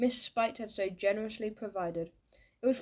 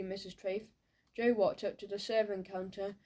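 usual speaking voice

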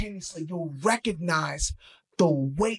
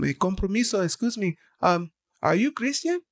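put-on higher, tentative Spanish-accented English; imitated Spanish accent; 3 semitones higher than usual. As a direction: imitate a driver asking politely in a Spanish accent. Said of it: "forgive me of this accent".

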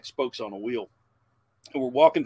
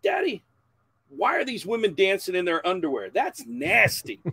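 high, whiny-kid imitation, louder on "nasty"; imitated young son; 8 semitones higher, 5 dB louder than usual. Young son asking dad about the dancing women, imitated high child voice. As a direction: pitch it up like an innocent nine-year-old. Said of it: "He's standing there like this going".

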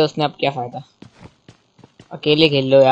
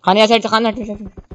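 calling out; 7 semitones higher than usual. Real, not acted. Calling teammate during fight.

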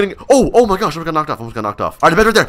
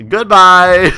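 drawn-out farewell; 6 dB louder than usual. Acted. Mock farewell before fireballs.

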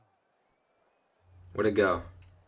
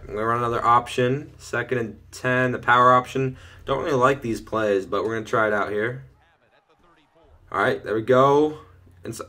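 usual speaking voice

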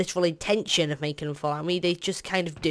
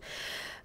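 long airy exhale; weary sigh; 15 dB quieter than usual. Real, not acted. Player fed up with the world, weary sigh. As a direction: let out a tired sigh. Sighs about hated world.

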